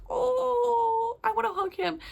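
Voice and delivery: high, teary gush of affection; tearful gush. Retold or imitated. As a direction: squeak it out as if close to happy tears. Gushing, wanting to hug characters.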